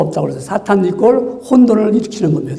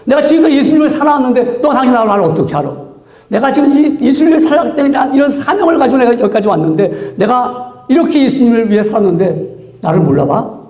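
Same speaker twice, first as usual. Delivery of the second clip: acted indignant protest, pitched up; wounded pride; 3 semitones higher than usual. Devoted believer to someone who doubts them, acted indignation. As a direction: demand recognition with mock outrage. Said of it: "내 자존심 상해요".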